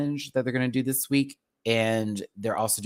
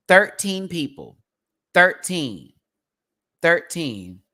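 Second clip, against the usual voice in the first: Thirteen, higher and louder, slowed; shocked disbelief; 4 semitones higher, 6 dB louder, slower than usual. Real, not acted. Reacting to thirteen queens, disbelieving. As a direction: repeat the number in disbelief.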